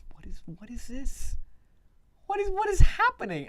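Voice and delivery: rising incredulous cry; baffled outburst. Real, not acted. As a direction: disbelief that climbs into a shout.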